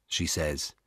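usual speaking voice

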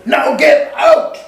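high, loud and slow bellow; furious slow roar, get out; explosive shout. A narrator's reading. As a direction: explode with the order. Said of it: "he re-, exploded".

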